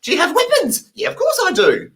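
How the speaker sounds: high cheery question and answer; clueless yes to weapons. Retold or imitated. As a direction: chirp it up high, oblivious. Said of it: "nodding away like a lunatic".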